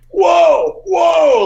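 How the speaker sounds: high, loud amazed whoa; Hulk Hogan shout. Retold or imitated. Wrestler reacting to a performance, amazed high shout. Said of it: "Hulk Hogan was like".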